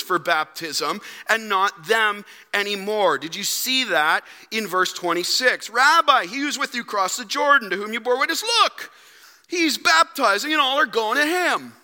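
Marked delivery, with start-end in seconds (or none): 5.7-11.7 high, loud whining complaint; complaining disciples. Acted. Disciples griping to their teacher John, high and whiny. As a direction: whine it like jealous followers.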